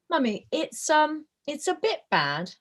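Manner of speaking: put-on high, slow, hesitant little-kid voice; six-year-old child. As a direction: imitate a small child gently giving an honest verdict. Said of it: "particularly my six-year-old".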